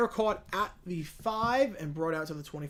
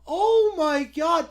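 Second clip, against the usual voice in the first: loud, slow, overjoyed; excited shout; 8 semitones higher, 8 dB louder, much slower than usual. Real, not acted. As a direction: cry out in joy. Reacting to gift.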